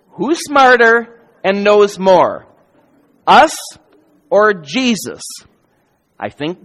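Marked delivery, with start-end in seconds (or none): 0.1-5.2 louder, slow, pressing the question home; slow it down and push it out to the crowd; emphatic louder repeat. Acted. Rhetorical question asked twice.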